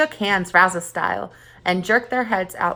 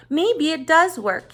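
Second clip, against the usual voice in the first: hopeful thought; 8 semitones higher, 3 dB louder, slower than usual. A narrator's reading.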